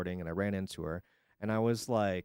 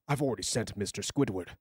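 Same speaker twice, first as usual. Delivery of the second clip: low character voice; 6 semitones lower, 5 dB quieter than usual. Acted. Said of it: "I can't do this for the entire fifteen minutes".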